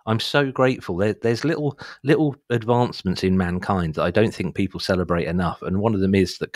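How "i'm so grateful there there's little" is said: ordinary talk, nothing marked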